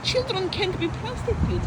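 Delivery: normal talking voice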